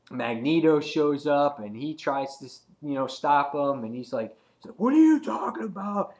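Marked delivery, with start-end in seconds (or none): 4.8-6.1 high-pitched, exasperated retort; hammed-up raised-voice objection; mock indignant protest. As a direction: act out an incredulous comic-book character. Voices a character arguing mid-battle.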